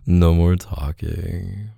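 ordinary talk, nothing marked

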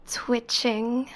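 ordinary delivery

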